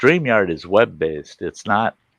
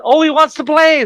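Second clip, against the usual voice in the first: much higher, louder tiny-dog voice; little dog's thought; 6 dB louder than usual. Retold or imitated. The Chihuahua thinking the big dog wants play, high tiny-dog voice. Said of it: "she thought".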